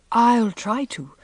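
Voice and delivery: stifled gasp. A narrator's reading. Teacher choking back laughter before a parent, gasping. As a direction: squeeze the words out over a suppressed laugh. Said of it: "gasped Anne".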